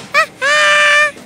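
short, high-pitched burst of laughter. Real, not acted. Laughs while playing.

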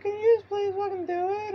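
very high falsetto mom with a mock cry. Mom begging the narrator, mock high crying voice. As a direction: whimper it like a panicked mom. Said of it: "She's like".

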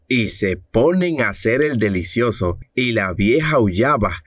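normal talking voice

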